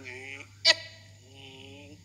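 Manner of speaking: drawn-out engine hum rising high; imitated car play. Father imitating his child playing in the car, engine noises. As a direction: mimic a child's car noises, long and slow. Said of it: "saya naik mobil, dia main".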